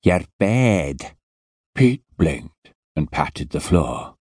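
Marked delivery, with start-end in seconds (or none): 0.0-1.2 put-on heavy Scottish accent, impatient and put out; a surly Scottish security man scolding; Scottish guard telling a boy to get up; grumpy Scottish guard. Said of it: "He rolled his eyes and said".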